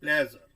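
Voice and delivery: Pleasure said loud with first syllable play stressed and falling; word stress on the first syllable; stress demo. Shown as a demonstration. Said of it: "जब इसको हम बोलेंगे".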